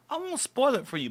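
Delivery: ordinary talk, nothing marked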